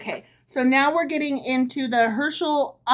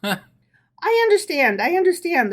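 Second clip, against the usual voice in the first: mild sympathetic reassurance, a touch higher; commiserating; 6 semitones higher, 4 dB louder than usual. A narrator's reading. Rabbi's wife reassuring Herschel, mildly sympathetic. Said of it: "assured him commiseratingly".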